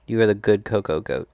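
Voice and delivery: ordinary delivery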